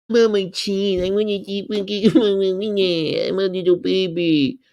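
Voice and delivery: put-on high, babyish whine; mock a cheese lover as a big baby; whiny baby mockery. Mocking someone who can't quit cheese, babyish whine.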